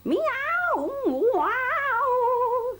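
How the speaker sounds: very high, loud yowl; cat yowl. A narrator's reading. Cats on the garden wall, very high yowl. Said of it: "sang the cats".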